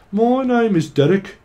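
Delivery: high, hammed-up Birmingham accent; Brummie accent. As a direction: introduce yourself as a cheeky Brummie. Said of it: "something from Brummie".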